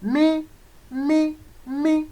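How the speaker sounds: "mi" repeated high and level. Shown as a demonstration. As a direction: lift every "mi" up high. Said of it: "the upper tone sign".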